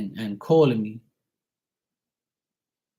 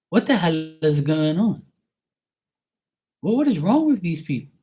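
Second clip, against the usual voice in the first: higher, bewildered outburst; pitched-up, fed-up questioning; retold exasperation; 5 semitones higher, 3 dB louder than usual. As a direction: exclaim to yourself in disbelief. Acted exasperation said to self.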